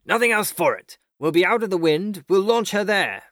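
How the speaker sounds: high, urgent rallying call; commanding cry. A narrator's reading. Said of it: "he cried".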